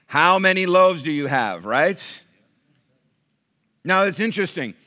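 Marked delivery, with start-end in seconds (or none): 1.7-2.0 loud, high, ringing preacher's cadence; impassioned pulpit preaching; full-force preaching. Acted. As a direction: preach it out to the church with fire. Preaching the bread of life.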